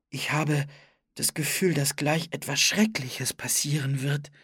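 ordinary delivery, nothing marked

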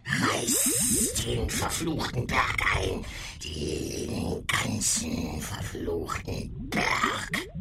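slow, eerie creature voice; monster voice. Acted. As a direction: demand it like a beast.

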